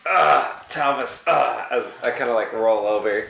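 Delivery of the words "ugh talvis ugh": a touch of sarcastic groaning. Acted. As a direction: drag out the name, mildly fed up. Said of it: "Sounds a little sarcastic".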